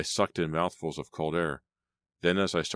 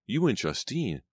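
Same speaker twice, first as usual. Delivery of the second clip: hurt; 8 semitones higher than usual. A narrator's reading. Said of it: "keep the hurt out of my voice".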